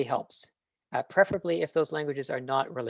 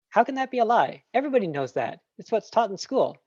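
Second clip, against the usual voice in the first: higher, indignant student protesting; imagined objector; 8 semitones higher than usual. Retold or imitated. Lecturer voicing a skeptical student, higher indignant protest. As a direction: play the doubter defending what school taught.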